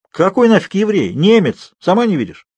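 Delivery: high, exasperated retort; irritated correction. Acted. Woman setting her naive roommate straight, exasperated.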